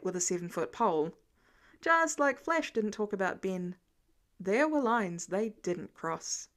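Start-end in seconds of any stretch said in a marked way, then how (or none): none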